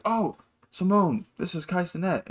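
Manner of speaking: playful mock introduction; hyped-up introduction. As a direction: present him like a star. Hype man introducing Kai.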